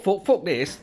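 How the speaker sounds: ordinary talk, nothing marked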